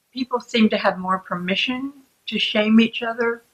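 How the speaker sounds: ordinary delivery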